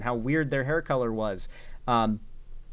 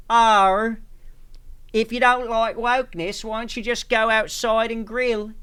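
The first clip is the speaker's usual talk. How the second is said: mock Australian heckle; 7 semitones higher, 5 dB louder than usual. Heckling Sydney Watson at her live show, hammed-up Australian accent. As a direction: yell it like an Aussie heckler at a show. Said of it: "just Australian racism".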